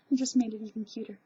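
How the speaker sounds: ordinary talk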